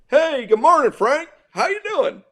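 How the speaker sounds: high-pitched, carefree mock greeting; breezy, cool as a cucumber. John greets upset coach next morning as if nothing happened, breezy. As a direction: imitate someone blithely chirping hello, voice way up. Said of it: "he was as cool as a cucumber".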